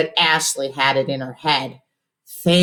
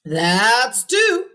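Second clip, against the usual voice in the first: hollered; 8 semitones higher, 3 dB louder than usual. A narrator's reading. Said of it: "Cousin Ralphie hollered".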